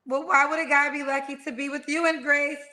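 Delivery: ordinary delivery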